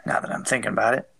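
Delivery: ordinary delivery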